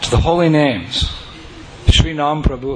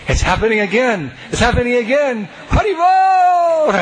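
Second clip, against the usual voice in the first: building, rising to a long high shout; ecstatic cry; 9 semitones higher than usual. Retold or imitated. Speaker crying out with joy as history repeats. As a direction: build from excited to a long high Hari Bol. Ecstatic thought, crowd dancing.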